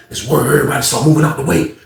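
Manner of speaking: ordinary talk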